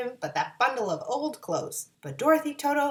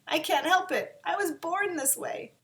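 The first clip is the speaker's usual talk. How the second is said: mock sob; 9 semitones higher than usual. A narrator's reading. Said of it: "sobbed the lion".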